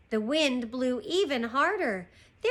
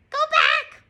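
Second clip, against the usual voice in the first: very high, loud parrot voice; piercing squawked words, far above usual pitch; 9 dB louder than usual. Acted. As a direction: screech it like a warning bird. Parrot warns again.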